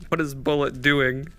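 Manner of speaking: ordinary talk, nothing marked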